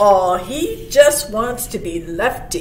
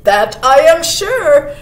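loud, raised, emphatic deer voice; storybook buck; 4 semitones higher, 8 dB louder than usual. A narrator's reading. Buck insisting the stranger was absent, loud and emphatic. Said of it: "answered the buck".

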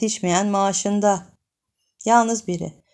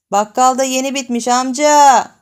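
thin voice; 4 semitones higher, 7 dB louder than usual. Acted. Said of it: "ince bir ses".

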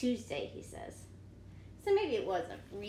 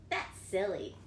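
ordinary delivery

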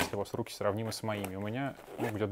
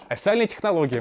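официальная pushed out louder and higher, mocking; sarcastic emphasis; 7 semitones higher, 9 dB louder than usual. Real, not acted. Reviewer mocking the manufacturer's advice, sarcastic emphasis. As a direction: sneer at the word официальная. Mocking the maker's official line.

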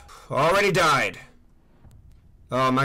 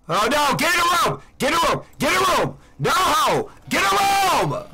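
frantic yelling; 10 semitones higher than usual. Real, not acted. Gamer chased by a monster, yelling frantic commands at his character. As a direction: scream the order over and over as it grows more desperate.

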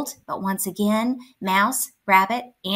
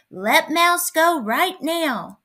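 loud, slow, raised demand; growled command; 8 semitones higher, 5 dB louder, slower than usual. A narrator's reading. Bear standing up to Bobcat for Mouse, growling. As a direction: order it forcefully, stretching each word. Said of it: "stood up on his hind legs and growled".

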